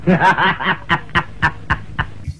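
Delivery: long rattling belly laugh; prolonged staccato laughter; hearty laugh. Real, not acted. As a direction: burst out laughing at your own joke. Laughs at own joke.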